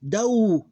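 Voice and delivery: Dow high and loud, held on the glide; separated syllable. Shown as a demonstration. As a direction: sound out Dow as its own syllable. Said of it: "Two open syllables".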